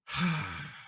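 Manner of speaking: long, audible exhale; heavy sigh of indecision; resigned sigh. Real, not acted. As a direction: let out a weary sigh. Unsure whether to open the box.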